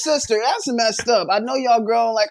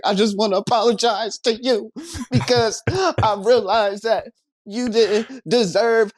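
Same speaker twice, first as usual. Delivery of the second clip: higher, halting tipsy confession; mock-emotional drunk apologizing, pitched up; drunken apology; 5 semitones higher than usual. Retelling a drunken phone apology to a sister, mock-tearful tipsy. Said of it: "I had been drinking with some friends".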